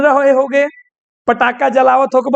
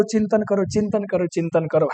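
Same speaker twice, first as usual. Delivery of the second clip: fading softer and lower; musing repetition; 4 semitones lower, 7 dB quieter than usual. Shown as a demonstration. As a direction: let each repeat sink away into quiet thought. Repeats the advice, fading like musing. (धीरे-धीरे मद्धम होती चिंतन भरी आवाज़)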